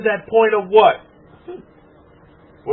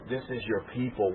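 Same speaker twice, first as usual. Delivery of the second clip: much softer, hushed quoting of a voice heard; soft inner voice; 8 semitones lower, 14 dB quieter than usual. Retold or imitated. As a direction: murmur it like a voice from within. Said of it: "I heard a feminine voice say".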